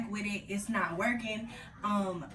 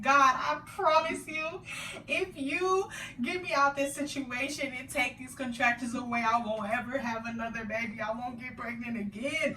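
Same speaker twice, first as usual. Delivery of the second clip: retold tearful prayer; 4 semitones higher than usual. Woman in labor pleading with God, crying. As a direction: bargain with God through tears. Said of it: "I'm crying to God".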